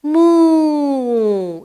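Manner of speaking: put-on a drawn-out playful moo for a child; cow moo. Shown as a demonstration. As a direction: moo like a cow for the little one. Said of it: "The cow says".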